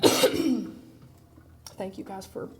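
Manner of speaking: hoarse from allergies; rough, croaky voice after a cough; groggy voice. Real, not acted. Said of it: "listening to my groggy voice".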